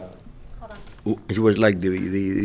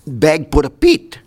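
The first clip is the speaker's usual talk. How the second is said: put-on Filipino accent; 3 semitones higher, 4 dB louder than usual. Pupil telling a joke, mock Filipino accent. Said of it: "This Filipino man".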